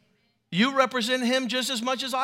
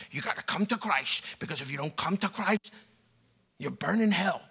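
low mock preacher voice; mock grim evangelist; 5 semitones lower, 4 dB quieter than usual. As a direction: imitate a joyless evangelist, pitched right down. Mocks a joyless preacher's evangelism.

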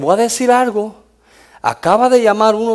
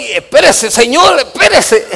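loud, higher, half-laughing call to wait; retold plea to stop; 7 semitones higher, 7 dB louder than usual. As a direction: hold the man back with a raised voice. Pastor calming an angry father.

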